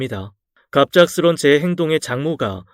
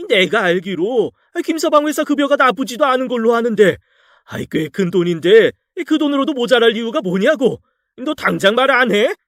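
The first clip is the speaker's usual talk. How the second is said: much higher, strained angry voice; furious demand; 8 semitones higher than usual. A narrator's reading. 딸을 다그치는 격노한 장인, 높은 고함. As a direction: demand the truth from his daughter. Father-in-law demands explanation.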